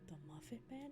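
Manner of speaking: soft wondering question; quiet questioning. Pretend. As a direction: ask it under the breath. Repeating the song line.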